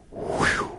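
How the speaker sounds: soft unvoiced breath out, like releasing a weight; exhaled whew. Real, not acted. Wishing to let go of his desperation, a soft breath out. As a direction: blow out a quiet relieved whew.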